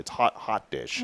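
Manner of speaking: lightly mocked Fargo accent, slow; a touch of North Dakota. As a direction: imitate a Midwestern friend's accent. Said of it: "my friends from Fargo".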